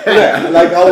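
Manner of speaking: amused disbelief; laughing. Real, not acted. Laughing at random Roy Jones moment.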